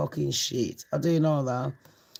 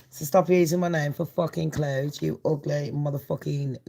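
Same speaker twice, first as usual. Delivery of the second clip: normal talking voice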